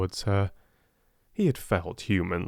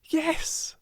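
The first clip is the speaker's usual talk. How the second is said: joyful. A narrator's reading. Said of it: "Sarah answered joyfully".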